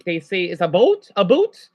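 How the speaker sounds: Canadian "about" joke; Canadian accent. Shown as a demonstration. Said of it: "of course, Canadian English, eh?".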